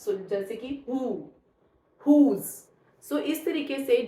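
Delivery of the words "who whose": "who, whose" starting with H, each word separate; silent W. Shown as a demonstration. Teacher lists exceptions, "who, whose" with H sounded and no W. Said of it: "W will remain silent".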